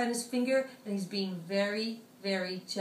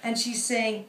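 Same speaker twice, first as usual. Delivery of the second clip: ordinary delivery, nothing marked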